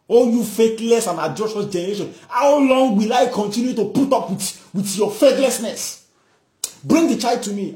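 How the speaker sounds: high, loud rebuke; exasperated rebuke. Acted. Jesus rebuking the faithless crowd, exasperated. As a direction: scold a whole generation, fed up.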